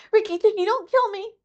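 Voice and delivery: a frightened little beast begging for its life; almost weeping. Acted. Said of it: "almost weeping".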